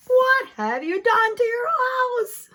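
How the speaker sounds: high, loud, anguished shout; shouting neighbors. Acted. Neighbors shouting at the man with the ship, loud and high. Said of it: "they shouted at the man".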